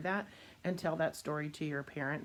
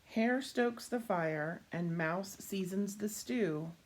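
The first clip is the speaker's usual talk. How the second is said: put-on reading to children; 2 semitones higher, slower than usual. A narrator's reading. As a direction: sell every rhyme to the kids. Reading picture book to children.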